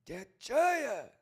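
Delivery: high, loud whooping call; catchphrase shout. Real, not acted. Streamer calling out to the chat, hyped shout. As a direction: belt it out like a signature cry.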